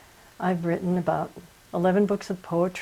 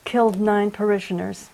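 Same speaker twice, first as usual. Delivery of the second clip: normal talking voice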